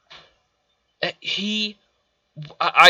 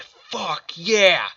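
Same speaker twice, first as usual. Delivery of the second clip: a hint of a triumphant cheer; mock Vader cheer; 5 semitones higher than usual. Viewer voicing Vader's glee after burning Obi-Wan, mild cheer. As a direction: cheer it lightly, like a villain. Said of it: "That's what Darth Vader's like".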